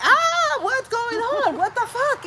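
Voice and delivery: high, loud startled cry; retold alarmed yell. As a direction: shout out in shock. Retold alarmed reaction to girls.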